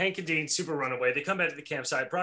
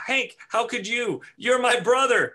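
high, loud wounded cry; hammed-up heartbroken brother; slow melodrama of betrayal; betrayed Dean; 9 semitones higher, 5 dB louder, slower than usual. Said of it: "says Dean".

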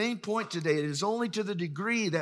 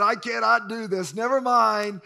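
higher, louder backing out; retold panicky refusal; 5 semitones higher, 7 dB louder than usual. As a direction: act out losing your nerve. Child backing out at the pool.

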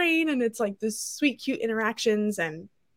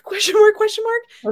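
mock puzzled; 11 semitones higher, 7 dB louder than usual. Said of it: "mock puzzlement".

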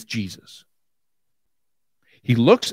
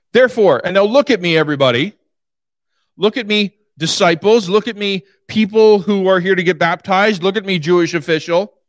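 raised preaching call, repeating "Look at me"; calling out to all; 5 semitones higher than usual. Acted. Preacher calls out to crowd.

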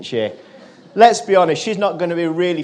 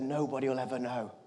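hushed, low confidential voice; quiet reassurance; 6 semitones lower, 14 dB quieter than usual. Retold or imitated. Conductor to boy without money, quietly confidential. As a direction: keep it very soft, as a kindly secret.

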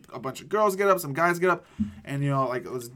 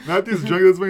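talking through loud laughter; laughing; 8 semitones higher, 15 dB louder than usual. Real, not acted. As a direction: laugh hard while telling it. Laughing while retelling.

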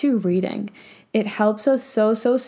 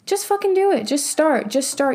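emphatic urging; 5 semitones higher than usual. Real, not acted. Urging viewers to act.

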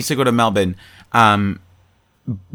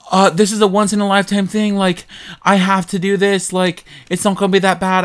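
put-on a light British accent, quick and higher; breezy British lilt, talking himself into it; mock British accent; 7 semitones higher than usual. Friend quoting Jerry reassuring himself in a light British accent. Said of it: "why he's British to me".

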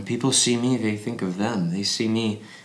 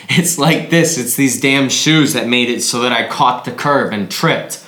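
loud, raised, straining with effort; agitated outburst; 3 semitones higher, 6 dB louder, slower than usual. Acted. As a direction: blame the boots in a heated burst. Slamming boot, blaming it for fall.

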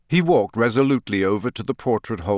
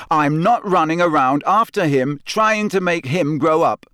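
loud, raised angry voice; 7 semitones higher, 5 dB louder than usual. Acted. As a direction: shout it out. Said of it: "Harry said so loudly".

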